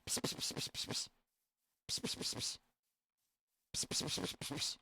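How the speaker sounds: psst psst to call animals; hissed psst to lure them. Real, not acted. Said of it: "Come here".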